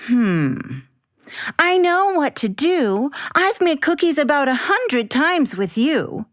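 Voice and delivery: put-on a little girl making her case; Abby as child. Abby convincing her mom, lightly voiced child.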